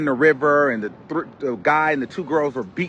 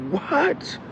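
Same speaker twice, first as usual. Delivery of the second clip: shocked what; 10 semitones higher, 4 dB quieter than usual. Retold or imitated. Shocked reaction in courtroom.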